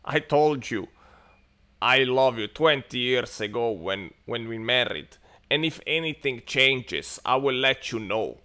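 loud, slow, matter-of-fact husband; deadpan Finnish husband. Retold or imitated. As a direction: answer her dryly, as the husband. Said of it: "Mikko is saying to Jana".

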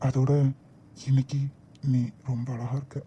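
soft, intimate compliment; tender. Acted. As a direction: murmur it lovingly. Husband romancing his wife. (மென்மையான காதல் குரல்)

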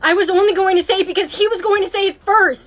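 loud, indignant self-defence; defensive yelling. Acted. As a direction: shout down the accusation. Accused student defends herself.

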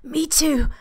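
high, breathless whisper; a smitten guest wheezing a reply; wheezed whisper. Acted. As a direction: barely get the words out, all air. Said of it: "John almost wheezes".

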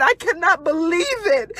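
ordinary delivery

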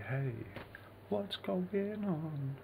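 high, drawn-out hello to the stream; cheery call-out to live viewers; sing-song greeting. Acted. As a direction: stretch the greeting up high and slow.

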